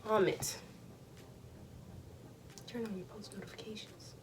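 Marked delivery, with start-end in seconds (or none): 2.7-4.1 whispering. Pretend. Whispered reminder to subscribe.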